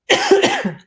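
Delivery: lingering choking cough. Real, not acted. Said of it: "it went down the wrong way".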